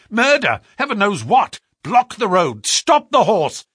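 loud, high urgent commands; urgent shouting. A narrator's reading. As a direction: bark out urgent orders. Holmes urgent orders.

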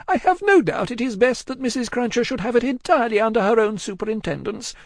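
high woman's character voice; woman composing herself. A narrator's reading. Miss Pross answering Cruncher, striving to compose herself. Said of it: "striving to dry her eyes and compose herself".

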